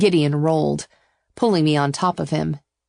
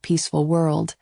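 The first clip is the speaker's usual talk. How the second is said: usual speaking voice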